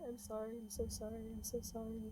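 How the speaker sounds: soft, fast mumbling of sorry; quiet hurried mumbling, repeating sorry; mumbled apology. Retold or imitated. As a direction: mumble it over and over, barely audible. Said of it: "mumbling that over and over again".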